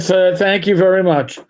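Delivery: ordinary talk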